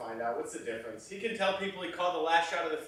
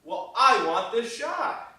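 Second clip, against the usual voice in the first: loud, slow and higher-pitched insistence; stubborn, emphatic demand; insistent; 7 semitones higher, 10 dB louder, slower than usual. Acted. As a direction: dig in, drawing out each word loudly. Argument over getting the shot.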